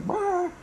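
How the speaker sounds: high, strained mock roar; monster roar. Arceus roaring at his surrounding mates. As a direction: let out a silly cartoon roar. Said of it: "now he's like".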